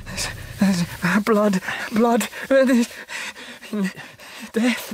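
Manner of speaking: rising, stammering panic; gasping out the horror he saw; terrified. Acted. Fiver wakes from nightmare.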